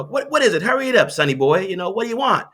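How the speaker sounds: high, snappy brush-off from a grumpy prospect; impatient grouchy man. Retold or imitated. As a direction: hurry them off the line, irritated. Impatient prospect voiced on phone.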